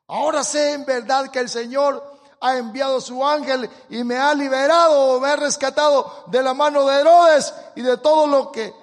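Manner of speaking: loud, high pulpit reading; forceful scripture reading. Acted. Preacher reading the verse aloud to the church, loud proclamation.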